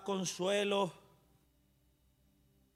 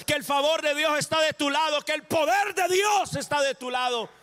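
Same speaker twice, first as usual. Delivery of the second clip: rising, louder and higher preaching build; full-force preaching; 8 semitones higher, 6 dB louder than usual. Acted. Preacher declares God's favor over listeners, building to loud. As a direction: climb in pitch and power with each line.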